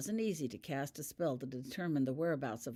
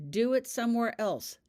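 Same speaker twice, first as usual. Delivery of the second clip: a loud, higher, unsympathetic command; 7 semitones higher, 8 dB louder than usual. A narrator's reading. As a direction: order her off without sympathy. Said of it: "commanded Harry without sympathy".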